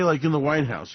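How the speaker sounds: usual speaking voice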